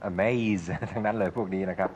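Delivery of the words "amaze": "amaze" drawn out to a buzzing Z; long voiced ending. Shown as a demonstration. Teacher gives examples of the rule, long voiced ending. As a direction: stretch "amaze" into its final Z. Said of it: "ต้องลากเสียงยาว".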